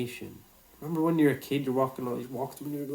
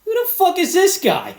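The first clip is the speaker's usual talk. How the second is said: who-is-this-guy disbelief, much higher and louder; thrown-up outraged inner voice; half-laughing mock indignation at a stranger; incredulous mock outrage; 13 dB louder than usual. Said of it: "I'm looking at him like".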